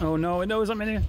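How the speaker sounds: alarmed as the monster finds him; panicked. Real, not acted.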